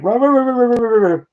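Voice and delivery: high yappy mock barks; imitated dog barking. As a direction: bark like the dogs downstairs. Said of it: "They're like".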